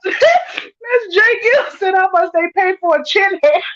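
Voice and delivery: reading through helpless laughter; cry-laughing over a chat comment. Real, not acted. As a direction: choke the words out while cracking up.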